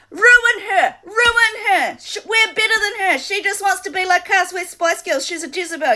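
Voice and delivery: mock the crowd that cheers her downfall; mocking the jeering public. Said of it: "you're all in the public like".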